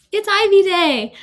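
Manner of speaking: high, loud and slow; excited announcement. Real, not acted. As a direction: announce the big day with a thrill. Announcing decision day.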